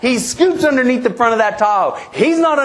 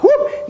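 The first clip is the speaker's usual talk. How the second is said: sudden very high-pitched whoop; quick, high whoop of escape; comic whoop. Retold or imitated. Driver bailing on the first body shop, comic whoop.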